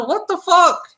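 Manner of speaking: very high, incredulous outburst; acted disbelief. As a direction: act out a stunned realization, pitch way up. Repeats the acted realization.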